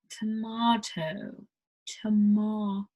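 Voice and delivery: "tomato" slowly as to-mah-to; slow British tomato. Shown as a demonstration. Teacher slowly demos "tomato" to learners. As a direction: stretch out to-mah-to. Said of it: "is tomato".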